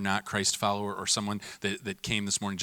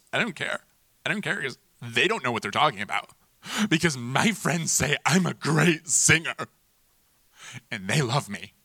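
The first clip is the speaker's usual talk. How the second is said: defiant contestant; 3 semitones higher, 5 dB louder than usual. Pretend. Contestant after elimination.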